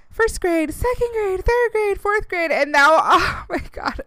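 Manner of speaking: high-pitched list, each grade squealed upward; high, overwhelmed cooing into a laugh; emotional gushing. Real, not acted. Gushing over followers' children growing up.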